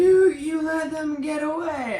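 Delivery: high, loud, drawn-out fury; hammed-up villain rage; mock outraged accusation. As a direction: yell the blame in a strained high voice. Voicing villain's furious blame.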